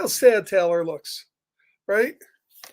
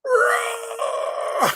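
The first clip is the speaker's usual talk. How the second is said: much higher, hammed-up roar; bear roar; 14 semitones higher than usual. Reader roaring again for the kids. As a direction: give a big playful roar.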